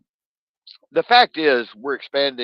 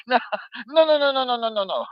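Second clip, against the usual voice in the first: high, excited run of no's, much higher than his own voice; imitating Bruno; 12 semitones higher than usual. Friend Bruno cutting him off, a high insistent imitation. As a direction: pitch way up, rattle off the no's like an insistent Frenchman. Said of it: "He's like".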